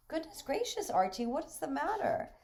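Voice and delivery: high, exclaiming surprise; startled concern. Acted. Visitor asks a panting boy what is wrong, startled. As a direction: jump up in pitch as if taken aback.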